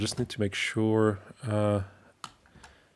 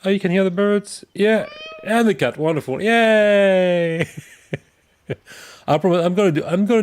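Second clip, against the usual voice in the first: excited; 9 semitones higher, 6 dB louder than usual. Real, not acted. Hearing the game audio works.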